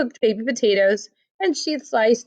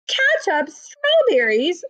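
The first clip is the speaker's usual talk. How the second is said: higher, drawn-out disbelief; incredulous echo; 8 semitones higher, slower than usual. Acted. Missus Lane echoing the food in disbelief, much higher. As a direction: repeat it in shocked disbelief.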